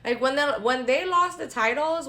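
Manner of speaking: ordinary talk, nothing marked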